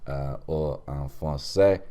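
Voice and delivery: halting put-on French accent; French-accented host. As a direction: switch into mock French. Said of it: "End of accent".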